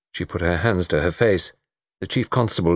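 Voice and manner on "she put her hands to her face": plain reading voice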